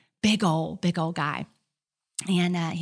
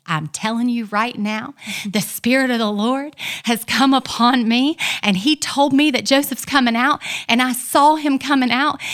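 loud, higher, preacher-like insistence; fervent preaching declaration; 6 semitones higher, 9 dB louder than usual. Retold or imitated. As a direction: proclaim it like a preacher on fire. Insisting to the wife he'll live.

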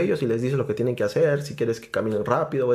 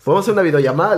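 higher and louder, eager; eager reply; 7 semitones higher, 7 dB louder, much faster than usual. Retold or imitated. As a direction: say it jumping at the chance. (respuesta ansiosa, más alta y fuerte)

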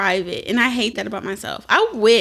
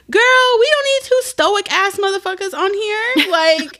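hyped up; 10 semitones higher, slower than usual. Real, not acted. Hyping up her co-host.

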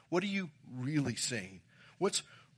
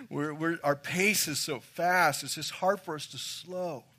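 ordinary talk

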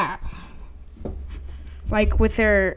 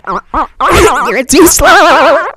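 loud, high, drawn-out warbling taunt; mocking bird; 4 semitones higher, 6 dB louder, slower than usual. Bird taunts Danny, mocking warble. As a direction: stretch it into a silly wobbly jeer. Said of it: "And the bird says".

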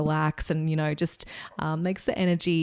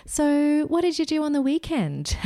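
much higher, bright small-talk question; chirpy phlebotomist; 11 semitones higher, 4 dB louder than usual. Retold or imitated. Phlebotomist distracting an anxious patient, high and chirpy.

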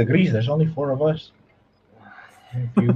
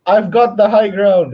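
lightly voiced Obi-Wan, slow and a bit louder; a playful hint of Obi-Wan; Ewan McGregor impression; 6 semitones higher, 5 dB louder, slower than usual. Answering the impersonation challenge, lightly voiced Obi-Wan. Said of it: "who can do the best Ewan McGregor impersonation".

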